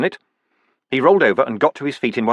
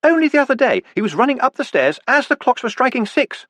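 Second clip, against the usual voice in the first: high, light lady's voice; a bright, fond wife speaking up high; Sybil's voice; 13 semitones higher than usual. A narrator's reading. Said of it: "Sybil said".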